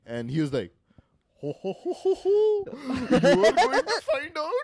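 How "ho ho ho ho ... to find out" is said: put-on high, loud laughing Indian accent. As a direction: cackle it out as a laughing Indian dad. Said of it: "I was doing Abu from, like, Simpsons".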